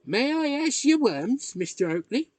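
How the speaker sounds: put-on piping choir boy's polite request; inquiring choir boy. A narrator's reading. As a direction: ask it up high like a choir boy. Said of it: "inquired the choir boy".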